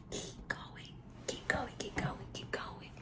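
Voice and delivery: breathy private whisper, no voice; urging yourself on in a whisper; whispered encouragement. Shown as a demonstration. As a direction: whisper keep going to yourself. Private self-talk encouragement.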